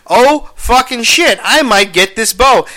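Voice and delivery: like you just realised you might win; excited disbelief. Retold or imitated. Retelling winning an eBay bid, excited. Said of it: "I'm like".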